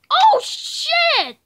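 shocked laughing shout. Real, not acted. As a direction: blurt it out in shock. Surprise item in game.

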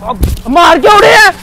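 loud, aggrieved shout; shouted protest. Real, not acted. Waiter to customer who hit him, shouted protest. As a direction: call out the blow in outrage.

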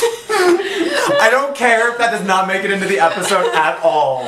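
talking through real laughter; laughing. Real, not acted. Reacting to a ruined take, laughing. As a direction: push the words out through laughter.

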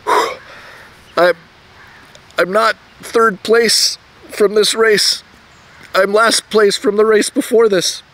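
high, slow, panting through the words; out of breath. Retold or imitated. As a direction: gasp it out after the finish. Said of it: "trying to catch my breath".

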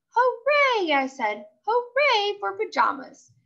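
high, gleeful cheer; real happy. A narrator's reading. Girl cheering about her favorite pajamas. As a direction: cheer it out, bouncing with joy. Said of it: "I danced all around the room real happy".

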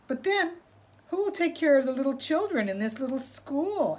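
high, innocent rabbit voice; sly rabbit. Acted. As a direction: play innocent, pitched high. Rabbit tricks coyote again.

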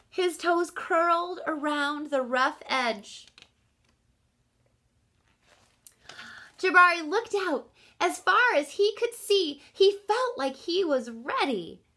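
rising suspense read aloud; building suspense. A narrator's reading. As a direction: build the tension toward the jump. Suspense build before jump.

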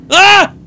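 startled high scream; sudden fright shriek; screaming in fear. Real, not acted. Jump scare in horror game.

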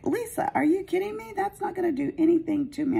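high, fast scoffing voice; scoffing client. Retold or imitated. Client scoffing at Lisa about getting help, high voice. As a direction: brush off the worry, high and quick. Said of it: "And she was like".

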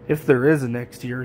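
ordinary delivery

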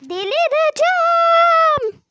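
wailing over and over at the game; frustrated loud wail. Real, not acted. Gamer wailing at the hard level, loud and frustrated.

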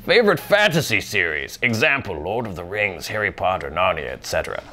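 mock wizard reading fan mail; old wizard. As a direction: read the list as an old wizard. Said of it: "like an old wizard voice".

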